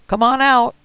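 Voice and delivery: high, loud, rough threat; old man with shotgun warning the kid, loud; armed old man threatening. Acted. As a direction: bark it through the door. Marty ordering with gun cocked.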